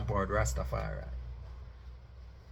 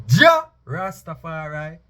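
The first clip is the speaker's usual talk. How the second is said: louder, slow and higher; powerful declaration; 5 semitones higher, 8 dB louder, slower than usual. Shown as a demonstration. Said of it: "when I and I declare".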